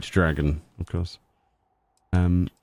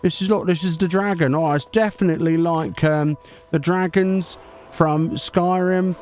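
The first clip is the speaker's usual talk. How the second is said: higher, bright-eyed 'hi guys' YouTuber hype; hammed-up YouTuber intro voice, pitched up; parody YouTuber voice; 5 semitones higher than usual. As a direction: play an over-eager clickbait YouTuber, guys after every phrase. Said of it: "We'll make our own Easter egg video then".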